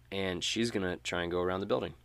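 usual speaking voice

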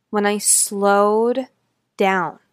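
very slow, drawn-out words with pauses. Shown as a demonstration. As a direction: stretch each word to make listeners perk up. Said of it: "there was a dramatic shift".

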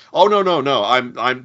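lightly acted flustered denial; flustered American actor, imitated. American actor correcting a mistaken refugee, mildly flustered. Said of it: "the totally American's like".

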